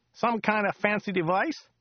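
high, chatty local driver voice; chatty driver Joe. Acted. Driver Joe chatting to Dirk on the drive, high chatty voice. Joe's voice.

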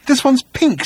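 high-pitched, baffled protest; puzzled complaint. Acted. Arthur finding a pink towel, baffled. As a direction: point it out in a high, put-out voice.

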